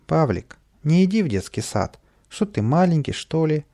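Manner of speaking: повыше, живой голос подстрекателя; lightly voiced tempter talking a pal into mischief; подначивает. A narrator's reading. Каприска подговаривает прогулять садик. (подначивающе, с лёгкой издёвкой)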